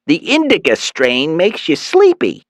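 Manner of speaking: high mother's voice; Mom voice. A narrator's reading. Mother correcting herself to son, high voice.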